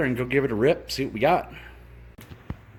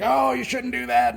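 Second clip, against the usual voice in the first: mock know-it-all; 13 semitones higher than usual. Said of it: "somebody's probably gonna be like".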